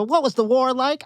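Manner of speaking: high, eager little question; eager grandkid. Retold or imitated. Grandson asking his grandpa about the war, high eager voice. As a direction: ask it like a curious kid. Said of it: "you ask Grandpa".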